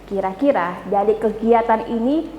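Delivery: ordinary talk, nothing marked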